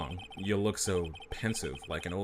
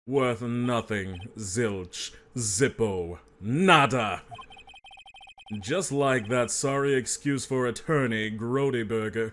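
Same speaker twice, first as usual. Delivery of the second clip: slow, pompous put-down; haughty villain sneering at a lawyer; contemptuous villain; 3 semitones higher, 4 dB louder, much slower than usual. Acted. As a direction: draw out each insult grandly.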